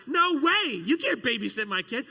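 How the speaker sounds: high, loud mock-outraged no way; emphatic refusal. Pastor loudly refusing to let students babysit his kid. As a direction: shut the kids down with a big theatrical refusal.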